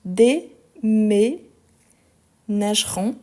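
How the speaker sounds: "déménageront" very slow, split dé, mé, nageront; syllable by syllable. Shown as a demonstration. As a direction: break "déménageront" into long separate syllables, stressing each é. Teacher sounds out accented syllables. (« déménageront » très lentement, syllabe par syllabe)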